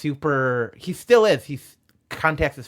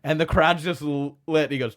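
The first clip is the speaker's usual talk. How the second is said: normal talking voice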